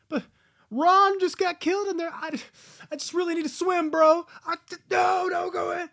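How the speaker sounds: high, loud, hammed-up shouting; mock panicked yelling. Swimmers arguing about going into the water, mock panicked yelling. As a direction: shout it like a movie character in a panic. Acting out arguing movie characters.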